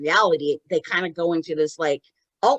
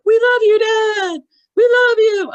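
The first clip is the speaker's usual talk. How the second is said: high, loud imitation of a family crying out; mock desperate yell; desperate calling out; 14 semitones higher, 9 dB louder than usual. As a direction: shout it across to someone far away. Retold family calling to dead father.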